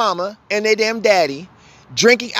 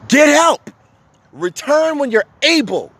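raised, forceful command; emphatic; 6 semitones higher than usual. Real, not acted. Urging abusers to seek help.

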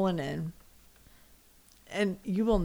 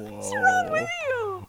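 much higher, slow and incredulous; outraged disbelief; 5 dB louder, slower than usual. Real, not acted. Wife reacting in shocked disbelief to the husband's excuse.

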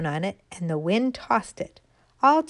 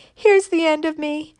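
high, louder, hurried lament; frightened despair; 7 semitones higher, 5 dB louder than usual. Acted. Fallen acorn lamenting in fright on the ground. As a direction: wail about being lost and useless. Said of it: "said the frightened little thing".

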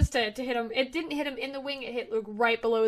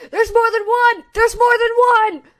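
high, very loud alarmed yelling; panicked scream; 11 semitones higher, 13 dB louder than usual. Acted. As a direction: scream a warning to the other guards. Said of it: "as they're screaming".

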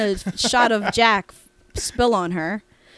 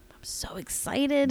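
breathless secret whisper, higher; excited whisper; 6 semitones higher, 4 dB quieter, slower than usual. Real, not acted. Whispering the secret to the podcast cohost, excited. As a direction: whisper it, barely able to hold it in.

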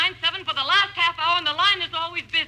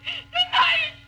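tearful, higher, urgent begging; frantic plea; 4 semitones higher than usual. Acted. Terrified invalid begging the operator for police. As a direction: cry and rush as if time is running out.

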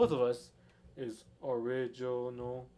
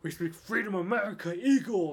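higher, louder hammed-up cheer; mock patriotic cheer; 5 semitones higher, 7 dB louder than usual. Cohost joining the America joke, hammed-up cheer.

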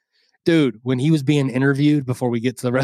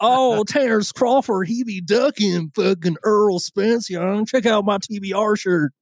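mock fan; 6 semitones higher than usual. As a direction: parody a clueless boxing fan. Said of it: "be like".

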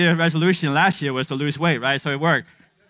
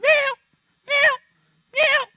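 very high-pitched imitated meows; three squeaky high meows, spaced apart; griping cat meow; slower than usual. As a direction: mimic a hungry cat complaining. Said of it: "The cat gripes sometimes when she's hungry".

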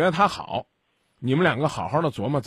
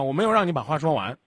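ordinary talk

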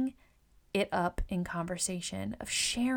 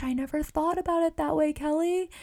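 amazed client; 8 semitones higher, 6 dB louder than usual. Retold or imitated. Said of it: "Oh my gosh".

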